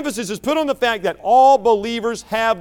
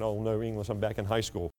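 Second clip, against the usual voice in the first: mock groan; 8 semitones lower, 12 dB quieter than usual. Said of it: "I know you're thinking".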